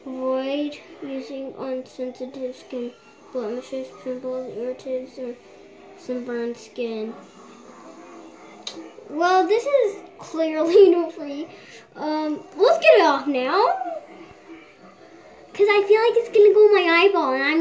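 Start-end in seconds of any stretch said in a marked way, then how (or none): 0.1-4.5 face covered by wet mask; reading aloud with mask on; wearing a sheet face mask. Real, not acted. Seen 3: Wet sheet mask covers her face while she reads the packet. 4.6-13.8 wet mask covering face; speaking through mouth cutout; talking with sheet mask on. Real, not acted. Seen 4: Wet sheet mask covers the whole face; mouth opening visible while talking.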